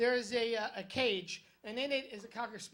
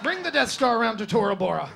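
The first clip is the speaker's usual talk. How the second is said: much louder, commanding villain order; mock Vader command; 11 dB louder than usual. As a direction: bark the order like a movie villain. Darth Vader-style command parody.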